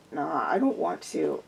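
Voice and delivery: low, soft lazy Scottish refusal; tired Scottish accent, muttered; harsh Scottish brogue. A narrator's reading. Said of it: "I said in a harsh Scottish brogue".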